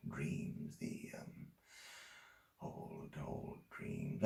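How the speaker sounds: very soft, halting, fading almost to breath; haunted hush. Acted. Man relives sleepless nights after her words hit him, haunted hush. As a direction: sink into the memory, barely voiced.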